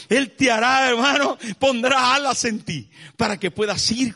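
high, loud, impassioned preaching that presses each question; preaching at full force. Acted. Pastor pleading with his congregation, preaching at full force.

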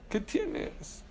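pregunta susurrada, aguda y sin voz; repítelo en un susurro dramático. Shown as a demonstration. (en voz baja, como un secreto)

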